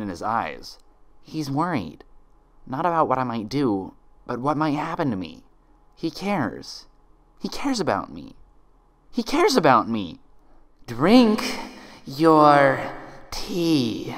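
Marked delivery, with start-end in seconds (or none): 1.2-10.2 rising hopeful realization; dawning relief in her own head; worried Twilight. Acted. Twilight to herself, hope rising. 10.9-14.1 high, loud coaxing menace; sinister command. Acted. Imposter pressing Twilight to drink, sinister.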